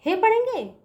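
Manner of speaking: teacher voices the wrong reading हे with a questioning lift; mock wrong reading. Shown as a demonstration. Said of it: "नहीं बच्चों".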